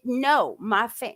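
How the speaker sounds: normal talking voice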